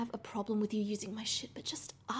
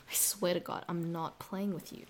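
usual speaking voice

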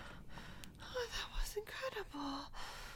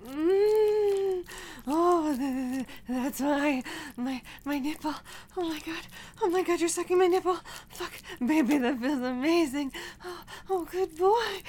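rising higher and louder, breathless cries; building to climax; 6 semitones higher, 3 dB louder than usual. Acted. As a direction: escalate from moans to cries. Mounting arousal to climax.

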